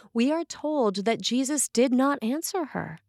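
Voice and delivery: ordinary talk, nothing marked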